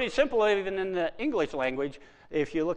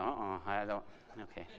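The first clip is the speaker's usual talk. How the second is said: unsure mumble; 10 semitones lower, 13 dB quieter than usual. Retold or imitated. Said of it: "the other ones are going".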